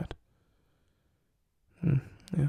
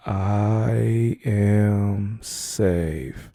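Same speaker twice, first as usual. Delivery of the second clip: very slow affirmation; much slower than usual. Shown as a demonstration. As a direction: draw out each word very slowly and calmly. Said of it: "together now".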